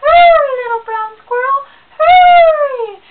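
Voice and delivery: very high, loud desperate cry; grandma shrieking at the squirrel to hurry; frantic yell. Acted. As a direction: scream for him to hurry home. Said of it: "she starts yelling".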